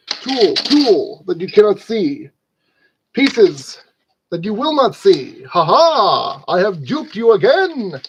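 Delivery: loud, hammed-up trickster boast; mock villain gloating. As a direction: gloat loudly like a pantomime villain.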